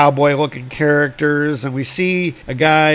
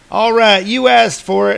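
higher, louder cowboy challenge; cowboy shout; 7 semitones higher, 4 dB louder than usual. Retold or imitated. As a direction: call it out like a gunslinger. Sundance shouting back.

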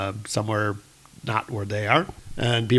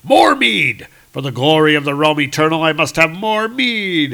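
higher, louder hearty bellow for mead; viking shout; 4 semitones higher, 6 dB louder than usual. Acted. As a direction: bellow it like a drunk Norse god. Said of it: "and Thor shouting".

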